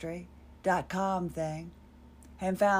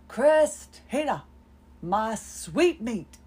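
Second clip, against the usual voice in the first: imitating grandmother's Sioux Indian accent, high, loud and slow; put-on Sioux accent; 3 semitones higher, 6 dB louder, much slower than usual. Grandmother calling her granddaughter in a Sioux Indian accent, drawn out. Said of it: "she spoke in the most ... Sioux Indian accent".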